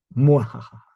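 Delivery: evil villain cackle; mad scientist laugh. Pretend. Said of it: "Oye, tranquilo, viejo".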